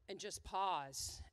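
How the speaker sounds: a slow, breathy mock gasp, then a hush; retold gasp. Speaker imitates the father's gasp at the finish line. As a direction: act out the father catching his breath. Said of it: "audibly hear his father just go".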